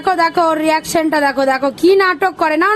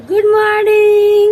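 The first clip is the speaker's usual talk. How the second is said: put-on slow, stretched গুড morning for a toddler to copy; slow prompt; 2 semitones higher, 3 dB louder, much slower than usual. Shown as a demonstration. Mother modelling the greeting for her little son, slowly. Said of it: "বলো গুড morning".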